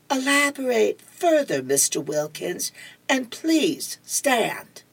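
high, soft, drawn-out teacher voice; slowly request it in a high, prim teacher's voice; schoolteacher. Acted. Teacher calling on Mister Wilkins, high measured teacher voice.

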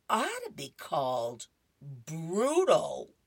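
slow, lightly voiced dry put-down; deadpan the insult; dry Barry. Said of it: "and says".

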